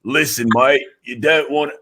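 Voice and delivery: put-on low British tough-guy accent; Idris Elba. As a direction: drop low and growl it like a London hard man. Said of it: "my inner Idris Elba".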